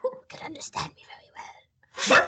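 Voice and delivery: creepy whisper breaking into laughter; creepy whisper, laughing. Pretend. Keeping up the creepy whisper for the podcast, cracking up. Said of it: "like this?".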